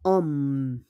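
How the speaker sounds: "Om" with no H, straight on the vowel; silent H. Shown as a demonstration. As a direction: model "Om" slowly for learners. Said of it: "Hindi natin pinopronounce yung H".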